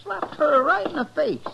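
high, agitated exclaim; shocked outburst. Acted. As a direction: blurt it out in shock. Chester sees girl being slapped.

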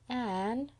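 small, high pleading call. A narrator's reading. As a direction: call softly for help, voice rising. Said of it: "a pleading voice said".

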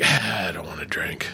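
breathy half-whisper, low and soft; imitated whiny buddy. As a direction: play the reluctant friend mumbling his refusal. Buddy refusing drinks, retold.

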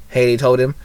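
usual speaking voice

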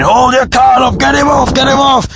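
high, insistent heckler yell; imitated crowd shouting. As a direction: holler for the act to be thrown off. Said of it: "other people in the crowd who are shouting".

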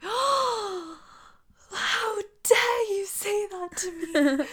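high, slow, scandalised prim voice; prim Charlotte. Retold or imitated. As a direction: play a shocked prim lady, drawn out. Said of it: "Charlotte, being Charlotte".

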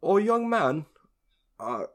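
a rough attempt at a South African accent; imitating a drunk South African guy at the bar; imitated South African accent. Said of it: "his heavy South African accent that I could try to imitate".

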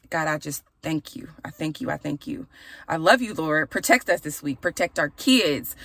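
normal talking voice

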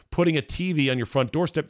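ordinary talk

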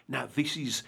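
ordinary talk

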